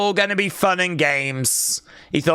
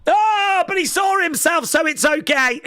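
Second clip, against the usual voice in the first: imitating kid; 9 semitones higher than usual. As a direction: play the crying kid, now cheered up, with a laugh. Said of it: "That kid's crying as well".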